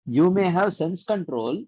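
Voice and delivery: ordinary delivery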